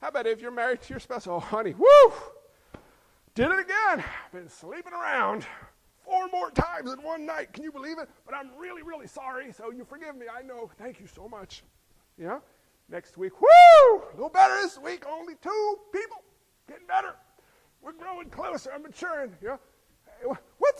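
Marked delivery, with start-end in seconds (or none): 1.2-11.6 high, cheery confession of cheating; breezy and unbothered, then cooing; mock cheating spouse. 13.4-19.3 high, loud, chirpy boast; cheerfully proud of cheating less; cheating spouse bragging about progress; mock proud cheater.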